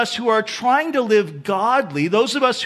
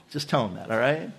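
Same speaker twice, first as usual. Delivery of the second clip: whispered aside; 9 semitones lower, 6 dB quieter, faster than usual. Real, not acted. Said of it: "whispered aside".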